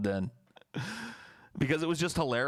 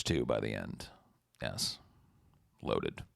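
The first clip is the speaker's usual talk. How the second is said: much lower, softer joke voice; mock deep voice; 11 semitones lower, 4 dB quieter than usual. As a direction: drop way down for the punchline. Crude joke punchline.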